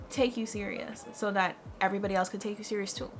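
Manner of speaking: ordinary talk, nothing marked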